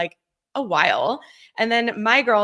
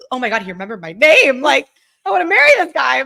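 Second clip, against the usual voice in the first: higher and louder, a giddy rush of a crush; giddy swoon; 5 semitones higher, 6 dB louder than usual. Retold or imitated. [name] retelling her swoon over James, giddy and excited. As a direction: gush like a smitten girl.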